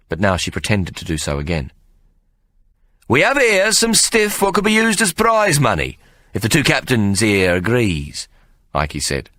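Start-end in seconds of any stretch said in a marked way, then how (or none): 3.1-8.3 Ikey's raised showman voice. Acted. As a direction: hawk it like a fairground barker. Ikey voiced addressing crowd.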